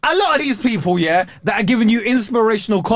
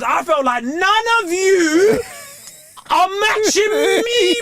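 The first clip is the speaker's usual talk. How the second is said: hammed-up boast; 9 semitones higher, slower than usual. Real, not acted. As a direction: drag it out triumphantly. Boastful climax of story.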